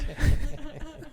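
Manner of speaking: squeaky high-pitched giggle. Pretend. Laughing at his own joke in a high falsetto giggle.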